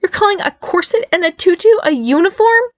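high, squeaky incredulous challenge; skeptical. A narrator's reading. Barry disputes that a tutu is a uniform, skeptical. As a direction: question it as if it's absurd. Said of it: "Barry challenged skeptically".

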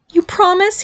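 high-pitched, anxious demand; desperate. A narrator's reading. Barry needs his mother's reassurance, desperate. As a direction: ask with a touch of desperation. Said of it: "he demanded desperately".

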